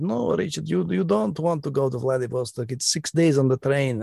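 lightly voiced Russian minder in a Russian accent, warning; Russian KGB minder. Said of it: "our K G B minder at college in, in Moscow said".

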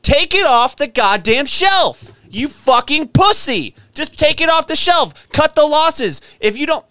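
really losing it, voice up and hammering; screaming rant. Real, not acted. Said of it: "I'm gonna stop screaming".

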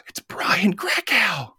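slow, unvoiced stage whisper; loud whisper. Rayanne telling Sharon who is calling, loud whisper. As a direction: hiss the name in a loud whisper. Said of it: "whispers very loudly".

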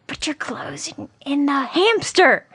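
high, slow imitation of a dad yelling; mock parental yell. Wife imitating husband yelling at their daughter, mock yell. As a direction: mimic an exasperated telling-off without full volume. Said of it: "so you're yelling at her".

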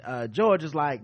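usual speaking voice

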